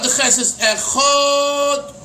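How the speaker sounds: Echad drawn out slowly on the ches; slow wrong stretch of Echad. Shown as a demonstration. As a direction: overdo the middle of Echad, wrongly. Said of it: "That's wrong".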